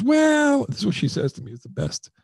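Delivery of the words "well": high, mock woman's voice; a hammed-up high "Well"; imitating the woman. The woman on the phone, imitated saying well. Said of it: "She goes".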